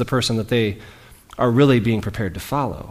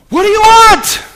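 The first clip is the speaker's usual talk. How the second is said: much higher, much louder shouted question; angry yell; 13 dB louder than usual. Shown as a demonstration. Jesus snapping at the men tailing him, full shout. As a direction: bark it out at full volume. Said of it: "was the tone".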